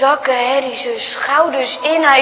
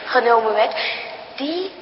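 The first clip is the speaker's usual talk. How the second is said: a hint of a weak, soft mother; a sick mother's soft, low voice; faint mother; 4 semitones lower, 7 dB quieter than usual. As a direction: let the words fade out of a tired body. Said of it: "Bijna horen ze niet wat moeder zegt".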